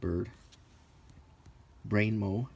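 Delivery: ordinary talk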